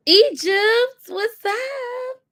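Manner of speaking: high, sing-out hello; excited greeting. Real, not acted. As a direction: call out brightly to someone just arriving. Greets an arriving viewer.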